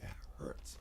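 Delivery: soft whisper; hushed ache. Real, not acted. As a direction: whisper it gently. Quiet emotional point.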